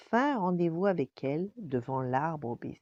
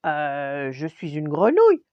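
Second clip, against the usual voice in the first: loud protest after a drawn-out "euh"; stall, then object loudly as the frog; hesitant attempt to explain; 11 dB louder than usual. A narrator's reading. Frog trying to explain to the princess, hesitant protest. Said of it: "tenta d'expliquer Grenouillet".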